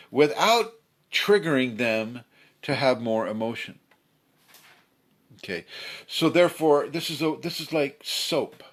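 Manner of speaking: usual speaking voice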